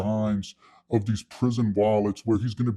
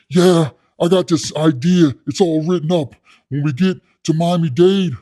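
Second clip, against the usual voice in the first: louder, higher schemer's pitch; hyped-up cellmate; 4 semitones higher, 5 dB louder than usual. Retold or imitated. Inmate pitching prison wallet blueprints, hyped up. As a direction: imitate an inmate selling his big idea.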